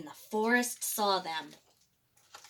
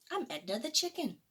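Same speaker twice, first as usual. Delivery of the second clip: chicken voice; 2 semitones higher, 7 dB quieter, faster than usual. A narrator's reading. Chicken answers the T. rex.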